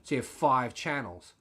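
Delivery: ordinary delivery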